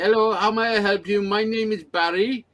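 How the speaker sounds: mock Indian call-centre accent. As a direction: greet the caller like an Indian call-centre agent. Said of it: "with an Indian accent".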